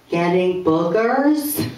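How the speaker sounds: put-on slow, deadpan little kid; straight-faced kid. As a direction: answer dead serious, like a first grader. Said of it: "straight faced".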